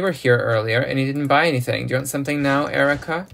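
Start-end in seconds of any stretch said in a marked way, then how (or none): none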